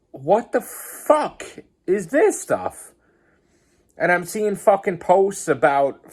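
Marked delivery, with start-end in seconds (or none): 0.1-2.9 shocked disbelief. Retold or imitated. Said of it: "and I'm like".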